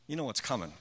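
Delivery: ordinary talk, nothing marked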